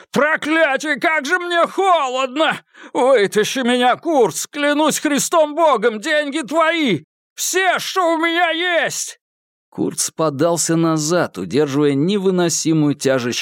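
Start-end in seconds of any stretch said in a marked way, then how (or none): 0.1-9.2 high, frantic pleading voice; desperate frozen man. Acted. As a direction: beg for life, freezing in the river. Malcolm begs from icy water. (отчаянная мольба замерзающего)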